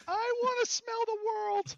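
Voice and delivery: high, loud, slow squeal of yearning; excited cry. Retold or imitated. Young self hearing the Aussie's advice, high excited squeal. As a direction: shriek it like a thrilled kid. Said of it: "And I was like".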